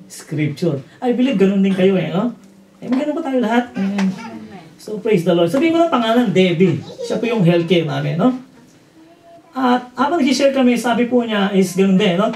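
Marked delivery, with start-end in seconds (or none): none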